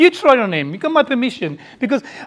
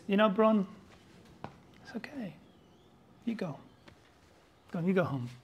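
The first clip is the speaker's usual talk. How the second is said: soft lenient judge; 2 semitones lower, 14 dB quieter, faster than usual. Acted. Lenient judge letting murderer off.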